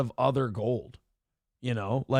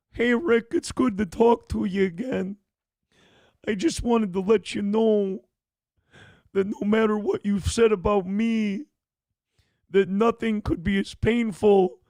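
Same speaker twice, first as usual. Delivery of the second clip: higher-pitched wrestler promo voice, halting between phrases; Mankind; 6 semitones higher than usual. Acted. Comedian rehearses a promo to Rick over the phone, put-on wrestler voice. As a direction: build a promo in a strange, raised voice. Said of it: "we could do a Mankind promo".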